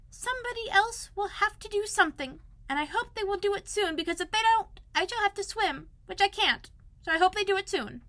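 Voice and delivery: high little Piglet voice, fretting; a small worried animal talking to himself, pitched high. Acted. Piglet alone in the rain, wishing for friends, high fretful voice.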